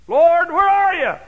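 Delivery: high, loud, slow cry to the Lord; desperate call. Retold or imitated. Believer calling out to God, loud slow cry.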